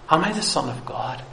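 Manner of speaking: very soft, doubting question to oneself. Acted. Jesus tempted to question who he is, quietly doubting. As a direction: wonder it under your voice. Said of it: "Seeking to sow that seed of doubt".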